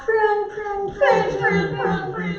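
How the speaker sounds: small, squeaky and high; tiny pinched prune voice. Shown as a demonstration. Drama teacher shows students the prune warm-up, tiny high voice. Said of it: "make your face very small".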